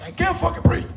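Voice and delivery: high, strained, choked voice; RFK Junior. Acted. Comic answering the cop, as RFK Junior. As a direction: gasp it out high and croaky, exasperated. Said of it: "I sounded like R F K Junior".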